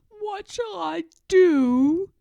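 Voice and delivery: loud, slow, high wail; desperate loud outcry, drawn out; cried out. A narrator's reading. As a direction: Toad crying out in despair. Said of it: "cried Toad".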